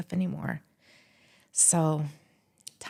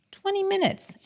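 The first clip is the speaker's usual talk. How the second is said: "Twenty minutes" jumped much higher with amazement; emphatic repeat; 14 semitones higher than usual. Real, not acted. Repeating the answer to the host, high and amazed. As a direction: hit "Twenty" high and incredulous. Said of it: "Do you know how long it took me".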